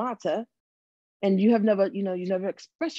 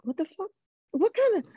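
high, startled outburst; floored by what he said; shocked; 13 semitones higher than usual. Retold or imitated. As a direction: pitch jumps up in shock. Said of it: "And I'm just like".

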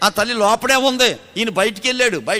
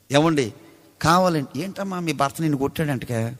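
mock taunt; 7 semitones lower, 6 dB quieter than usual. Mimics gossiping neighbours' taunt.

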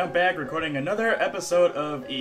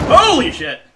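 high, loud shocked yelp; startled panic; 9 semitones higher, 11 dB louder than usual. Real, not acted. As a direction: jump out of your skin. Chased by monster, startled.

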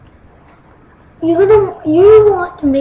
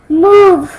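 louder, sharper move; 3 semitones higher, 9 dB louder than usual. Real, not acted. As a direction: bark it loud. Repeated move getting louder.

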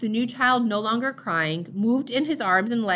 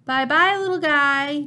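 put-on baby voice; 5 semitones higher, 4 dB louder, slower than usual. Father's cheerful baby voice to infant.

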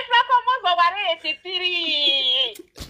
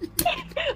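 short high-pitched laugh; real amused laugh; 12 semitones higher, 7 dB quieter than usual. Real, not acted. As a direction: burst out laughing. Laugh heard.

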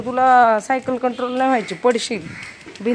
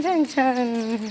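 slow drawn-out praise; 4 dB quieter, much slower than usual. Real, not acted. Mother admiring her son riding well, slow drawn-out praise. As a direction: stretch each छान out slowly and softly.

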